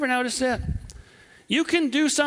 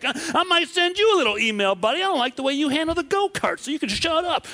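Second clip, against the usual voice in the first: mock petty revenge; 6 semitones higher than usual. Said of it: "I'm like".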